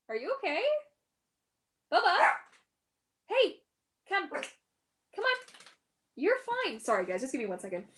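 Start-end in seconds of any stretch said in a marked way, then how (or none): 0.1-6.8 much higher, sing-song pet talk; soothing the dog. Real, not acted. Owner reassures her dog in high cooing pet talk. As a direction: coo it to a scared pet.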